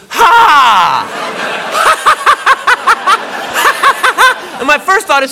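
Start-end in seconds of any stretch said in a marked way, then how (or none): 0.1-4.5 a loud bark of Ha! breaking into high cackling laughter; mocking loud ha then high laugh. Girlfriend laughing at what she found, loud mocking ha and laughter. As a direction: burst out laughing at him. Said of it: "she goes".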